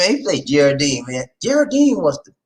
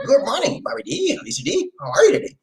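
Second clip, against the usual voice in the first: higher, squeaky penguin-like greeting; penguin Lamont; 8 semitones higher, faster than usual. Retold or imitated. Host's penguin version of Lamont greeting the hosts, higher. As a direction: chirp the hello like a penguin. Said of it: "You sound like penguin".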